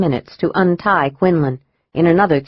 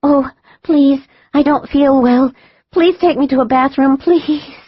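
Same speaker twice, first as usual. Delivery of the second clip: higher, pleading, pretend-sick whimper; faked sick whimper; 8 semitones higher than usual. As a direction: fake being ill to fool the guard. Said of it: "heard Corey whimper".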